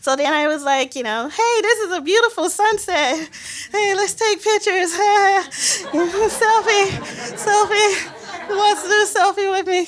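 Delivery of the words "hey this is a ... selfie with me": high-pitched, over-eager chirping with laughs; fake cheerfulness. Stalling so colleagues think she's cool, fake cheerfulness. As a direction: play up desperate enthusiasm, way up high.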